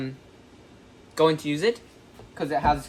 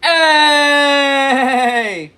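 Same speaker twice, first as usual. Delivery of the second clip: excited shout; 8 semitones higher, 12 dB louder than usual. Real, not acted.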